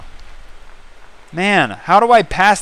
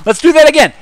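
Let's do THAT again, pitched way up and louder; high excited repeat; 10 semitones higher, 5 dB louder, much faster than usual. Shown as a demonstration.

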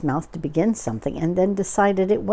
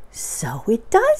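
slow, raised voice of wonder; marveling Pooh; 5 semitones higher, slower than usual. Acted. Pooh watches the balloon go in, slowly marveling. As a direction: draw it out, moderately high.

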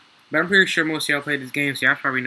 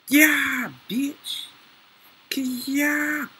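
real excitement, pitched up; excited yell; 10 semitones higher, much slower than usual. Real, not acted. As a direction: yell out a sudden win. Big win in the game.

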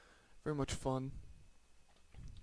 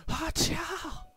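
much higher, louder triumphant yelp; excited battle cry; 6 dB louder than usual. Real, not acted. Gamer cheering after a double kill, excited yelp. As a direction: blurt out a wild victory whoop. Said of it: "I got a little too excited".

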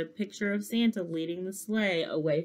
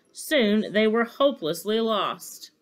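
plain reading voice

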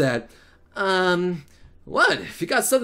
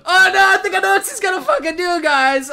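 high, fast panicked exclamation; excited alarm; 7 semitones higher, 4 dB louder than usual. Real, not acted. Player guessing her next move, excited alarm. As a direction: blurt it out high and quickly. Alarmed at what she will do.

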